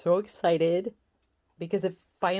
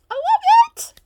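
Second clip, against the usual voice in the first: much higher, louder gushing squeal; excited squeal; 12 dB louder than usual. Real, not acted. Gushing over her new warmer.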